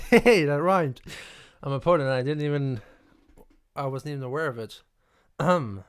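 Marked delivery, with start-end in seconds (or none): 0.1-2.8 laughing delight. Real, not acted. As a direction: burst out laughing at the rhyme. Notices his accidental rhyme.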